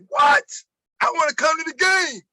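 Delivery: high, loud burst of disbelief; excited surprise. Retold or imitated. Friend hearing about tomorrow's game, imitated loud excited surprise. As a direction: leap up in pitch, imitating a thrilled friend. Amazed reply to game news, retold.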